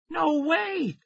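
very high disbelieving outburst; shocked cry. A narrator's reading. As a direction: refuse to believe it. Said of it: "Tara cried".

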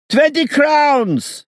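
very high, loud, slow screech; shrill falsetto yelling a price. A narrator's reading. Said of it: "screeched a falsetto voice".

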